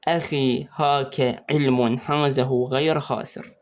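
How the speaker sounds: "أخي هاك علم حازه غير خاسر" ببطء شديد كلمة كلمة; أمليها على الطالب على مهل ليحفظها; إملاء بطيء. Shown as a demonstration. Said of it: "أول حرف من هذه الكلمات". (نطق بطيء واضح لجملة الحفظ)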